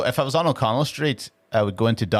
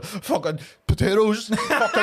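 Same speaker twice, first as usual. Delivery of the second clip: high, slow mock drunk heckler; drunk heckler impression; 10 semitones higher, slower than usual. Comedian mimicking a drunk Bradford heckler, higher and slow. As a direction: ham up a drunk heckler shouting slurs. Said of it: "he just started going".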